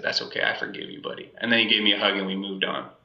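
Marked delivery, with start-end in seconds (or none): 0.0-1.3 much softer, gentle dad comfort; calm him down quietly; soft reassurance. Retold or imitated. Father forgiving his son.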